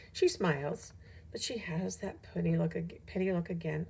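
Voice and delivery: ordinary delivery, nothing marked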